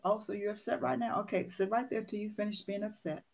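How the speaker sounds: soft, calm "sit right there"; calm grandma. Retold or imitated. Grandmother soothing pouting grandson, soft and calm. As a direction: gently let the child sulk.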